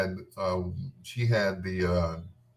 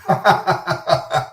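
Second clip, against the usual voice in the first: laughing; 9 semitones higher, 5 dB louder than usual. Real, not acted.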